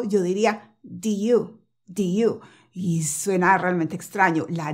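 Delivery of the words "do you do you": 'do you' with the D of did wholly dropped; D left out. Shown as a demonstration. Teacher showing students 'did you' minus the D, D dropped. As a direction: say 'do you', no D closing the first word. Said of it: "Si yo omito la D del todo".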